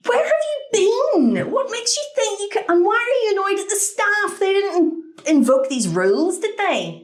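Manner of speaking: high-pitched, incredulous telling-off; indignant scolding. Acted. Telling off the cowboy-boot traveller who complained, indignant.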